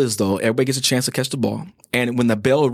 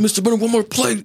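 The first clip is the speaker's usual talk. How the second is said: put-on whiny begging kid; 12 semitones higher than usual. Student begs the teacher again.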